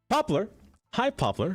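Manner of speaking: high, bright-pitched hello; excited greeting. Real, not acted. Streamer greeting Poplar mid-game, excited.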